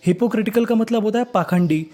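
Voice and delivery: ordinary talk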